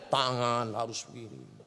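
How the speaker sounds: low, hushed formal preacher voice. Retold or imitated. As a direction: imitate a stiff formal preacher. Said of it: "Zainuddin M Z ceramah". (meniru penceramah formal dengan suara rendah)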